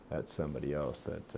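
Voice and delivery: ordinary talk, nothing marked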